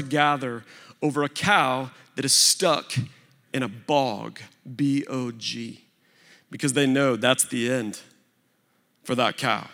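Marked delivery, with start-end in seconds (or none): 4.7-5.8 "B O G" spelled letter by letter, slowly. Shown as a demonstration. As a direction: spell "B O G" out one letter at a time. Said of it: "spells the word".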